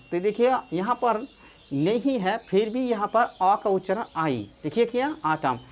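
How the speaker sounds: usual speaking voice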